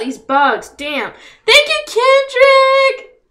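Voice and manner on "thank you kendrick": drawn-out thanks. Real, not acted.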